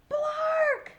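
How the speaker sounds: very high, loud squawk; loud bird squawk. Acted. Little Bird squawking at his friend Frog. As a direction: blast the squawk.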